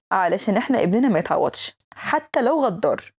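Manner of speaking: ordinary talk, nothing marked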